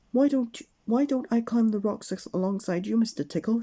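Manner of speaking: high, eager offer; offering help. Acted. Miss Brave offering to climb with Mister Tickle, high and eager. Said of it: "she offered".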